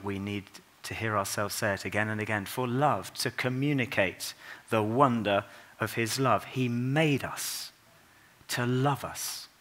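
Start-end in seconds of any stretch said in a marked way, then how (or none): 6.4-9.5 slow and deliberate. Real, not acted. Slow emphatic sermon line.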